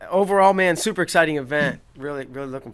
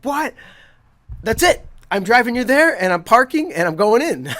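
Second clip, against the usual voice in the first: high, loud burst of excitement; excited driver; 10 semitones higher, 10 dB louder than usual. Retold or imitated. Uber driver hearing the World Cup is here, excited. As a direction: blurt it out high and loud, imitating a thrilled driver. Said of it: "He was so excited".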